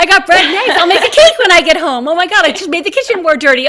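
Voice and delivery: high, loud, rushed self-talk; loud, high and racing; excited rush. Retold or imitated. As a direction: blurt it out like a frazzled home cook. Acting out her own busy thoughts.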